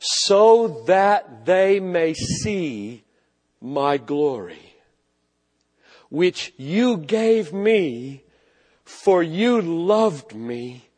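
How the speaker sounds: slow, weighty reading of a prayer; solemn scripture reading. Acted. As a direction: let each phrase of the prayer settle, very slowly. Reads Jesus's prayer slowly.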